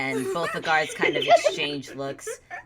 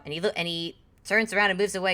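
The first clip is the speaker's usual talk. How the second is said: normal talking voice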